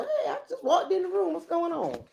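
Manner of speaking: high, fast and soft, a bit laughing; a bewildered newcomer blurting in; high giggly voice for the paper. Retold or imitated. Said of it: "paper was like".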